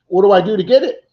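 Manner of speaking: high, loud imitation of an eager caller; urgent demand. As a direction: re-enact an urgent plea for the prize. Loser reacts on phone, retold.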